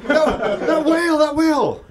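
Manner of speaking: high, loud laughing wail; wailing about the whale mid-laugh; freaking out. Retold or imitated. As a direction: cry out over the whale, laughing. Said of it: "I started freaking out".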